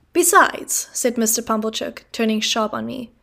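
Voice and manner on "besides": higher, louder outburst; pompous uncle. Acted. Pumblechook turning sharply on the boy, blustering.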